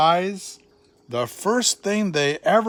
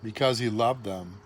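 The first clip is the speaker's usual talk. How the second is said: softer, lower, loving; tender; 6 semitones lower, 6 dB quieter than usual. A narrator's reading. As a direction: say it gently like a hug. Tender statement of love.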